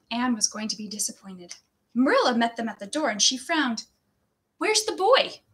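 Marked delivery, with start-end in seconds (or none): 4.6-5.4 much higher, rising demand; surprised. A narrator's reading. Stern woman at the door expecting a boy, surprised. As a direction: ask in frowning surprise. Frowning Marilla asks.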